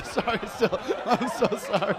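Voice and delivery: giggly sorry after a bad joke; laughing apology. Real, not acted.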